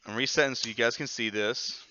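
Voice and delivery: usual speaking voice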